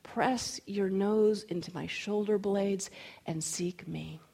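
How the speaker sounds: slow, sinking to a hush; the Spirit. Acted. The Spirit urging the runner to seek, slow and hushed. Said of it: "it was like the Spirit reminded me".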